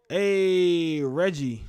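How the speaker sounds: long, high, loud drawn-out greeting; calling out. Real, not acted. Streamer hailing Reggie in chat, calling out. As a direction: holler it with a stretched-out hey.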